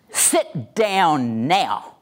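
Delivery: drawn-out, very slow command; aggressive. Shown as a demonstration. Trainer demonstrating aggressive command with "or else" behind it, threatening. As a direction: stretch each word into a threat. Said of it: "An aggressive voice says".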